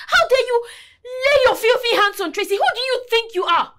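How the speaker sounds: high, loud indignant challenge; angry confrontation. Acted. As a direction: demand an answer, outraged. Confronting the abusive husband.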